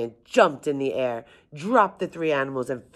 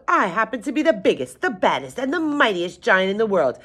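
high-pitched storybook giant bragging; yelled boast; 7 semitones higher than usual. A narrator's reading. Giant brags to the three animals, yelling. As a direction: proclaim it high, puffed up with pride. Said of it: "he yelled".

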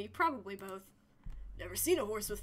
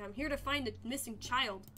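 ordinary delivery

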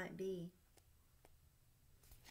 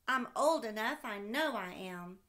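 put-on louder child voice insisting; insistent little boy; 8 dB louder than usual. Sam insisting to dad he is old enough, eager child voice. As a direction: plead that you're big enough. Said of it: "asked Sam".

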